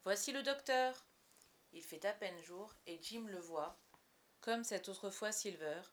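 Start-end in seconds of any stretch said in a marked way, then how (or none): 0.0-1.1 loud, raised announcing call; higher, ringing hail; cordial call. A narrator's reading. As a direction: call out the arrival heartily. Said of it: "par une voix cordiale".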